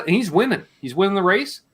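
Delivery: ordinary delivery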